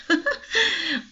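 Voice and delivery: short embarrassed laugh, real; nervous laugh. Real, not acted. Teacher laughs at her own nerves before the class, embarrassed laugh. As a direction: laugh off the slip.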